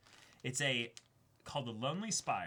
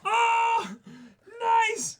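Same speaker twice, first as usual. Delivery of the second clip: much higher, much louder cry of joy; thrilled shout; 14 dB louder than usual. Real, not acted. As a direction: ecstatic outburst. Thrilled at the gift.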